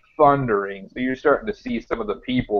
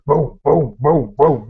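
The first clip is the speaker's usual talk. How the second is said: loud booming cannon noises; cannon booms; 4 semitones higher, 6 dB louder, slower than usual. Acted. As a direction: punch out each boom like cannon fire. GM voicing cannon fire.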